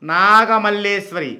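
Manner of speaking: నాగమల్లేశ్వరి stretched, falling at the end; slow pronunciation demo. Shown as a demonstration. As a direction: sound out NAA-GA-MAL-LEE-SWARI slowly. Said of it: "చూడండి".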